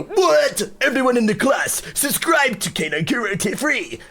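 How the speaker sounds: amazed teacher ordering the class to subscribe; excited teacher. Pretend. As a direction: shout to the whole class in shock. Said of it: "then he's gonna go".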